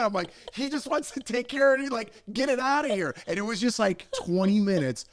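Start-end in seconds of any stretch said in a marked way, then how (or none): 0.5-3.1 high, pleading explanation; exasperated explaining. Retold or imitated. Storyteller explaining the guy to panicked family, exasperated. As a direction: plead for sense with raised pitch.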